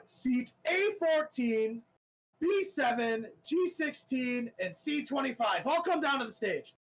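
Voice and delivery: high and slow, reading out each seat; nervous announcer calling seats. Acted. Announcer reading winning seat numbers, high and drawn out. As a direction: call the numbers like a shaky host. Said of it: "you notice he goes".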